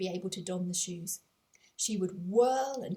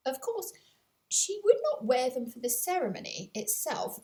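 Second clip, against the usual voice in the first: ordinary delivery, nothing marked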